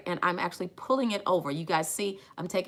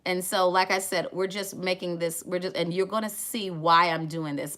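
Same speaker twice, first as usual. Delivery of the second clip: ordinary talk, nothing marked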